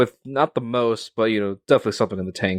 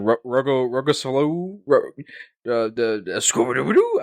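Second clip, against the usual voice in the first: lightly attempted impression, fumbling the name; Ryan; 4 semitones higher than usual. Co-host trying a guest's surname, halting playful impression. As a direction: stumble through a mock name pronunciation, giving up into nonsense. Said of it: "do my best Ryan, uh, Ryan impression".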